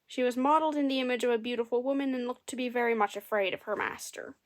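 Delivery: ordinary delivery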